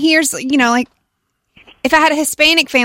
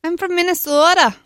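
higher, imitated Minnesota accent; 6 semitones higher than usual. Host mimicking someone from Minnesota, exaggerated accent. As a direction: put on a Minnesotan's long O. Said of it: "you can hear their accent".

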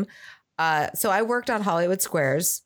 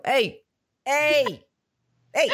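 higher, mock Jersey Shore greeting; Scott Baio; 7 semitones higher, much slower than usual. Practising the impression for dog walks, hammed-up greeting. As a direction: call out in a put-on accent. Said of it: "just do Scott Baio".

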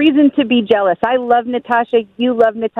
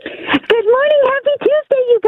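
high, bubbly morning greeting; happy and energetic; 11 semitones higher than usual. Real, not acted. Sister greets the radio hosts on air, happy and energetic. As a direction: greet them brimming with cheer. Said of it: "you're so happy and energetic".

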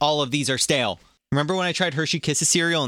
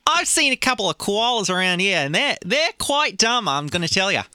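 put-on higher Australian accent; 4 semitones higher than usual. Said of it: "Do you like my Australian accent?".

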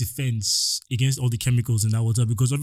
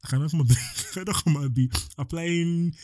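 laughing through the words, pitched up; 4 semitones higher than usual. Real, not acted. Laughing at the thought of scar cream on his face. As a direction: let a laugh break into the line. Laughs mid-rant about scars.

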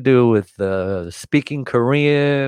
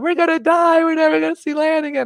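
high, fast panicked wail; freaking out. Retold or imitated. Seasick crewman panicking in the storm, high frantic wail. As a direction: panic like you're sure you'll drown. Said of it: "one guy starts freaking out".

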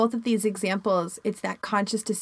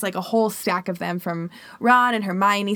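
normal talking voice

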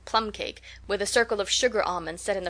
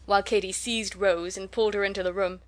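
ordinary delivery

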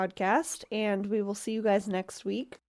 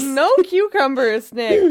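laughing; 5 semitones higher, 12 dB louder, slower than usual. Real, not acted. Teasing friend through laughter.

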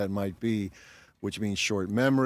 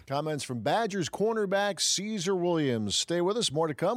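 radio announcer; 8 semitones higher than usual. Acted. Radio host announces break.

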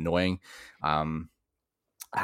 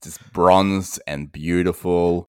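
slow drawl; 4 dB louder, much slower than usual. Pretend. Joking Aussie stereotype, drawn out.

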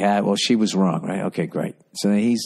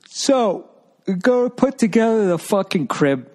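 exasperated storytelling; 13 semitones higher than usual. Retold or imitated. Story build-up, frustrated.

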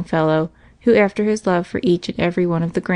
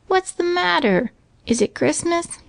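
put-on sleepy child; 11 semitones higher than usual. A narrator's reading. Sleepy boy woken in story.

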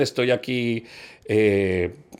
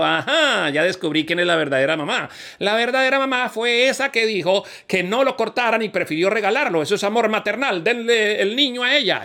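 Solomon's verdict; 9 semitones higher than usual. Acted. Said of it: "Y Salomón dijo".

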